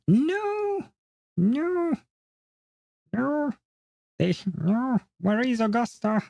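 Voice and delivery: high, strained no, no, no; rising pitch of real exasperation; exasperated squeal. Real, not acted. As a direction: squeal in frustration while searching. Frustrated searching for the trailer.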